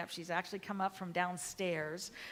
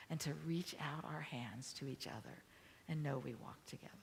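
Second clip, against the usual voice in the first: soft sermon; 4 dB quieter than usual. Acted. Soft reflective closing of sermon.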